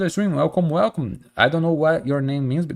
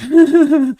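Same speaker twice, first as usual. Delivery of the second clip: a burst of high, loud laughter; 11 dB louder than usual. Real, not acted. Streamer laughing at her joke about Americans, loud high laugh.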